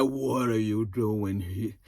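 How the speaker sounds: very slow, low, soft drawl; exaggerated sluggish imitation; mocked drawl. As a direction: drag it out low like he's drugged. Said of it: "that drawl is".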